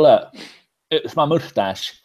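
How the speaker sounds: usual speaking voice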